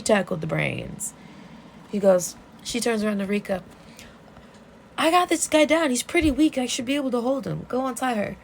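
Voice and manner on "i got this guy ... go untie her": high, raised voice giving mock commands; urgent acted orders. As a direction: call orders over a struggle, playfully acted. Urgent orders in retold fight.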